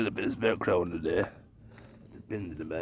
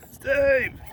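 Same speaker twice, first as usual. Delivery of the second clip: a sharp, high, louder call of the name; 4 dB louder than usual. Real, not acted. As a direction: yell his name to get his attention. Calling out to toy car.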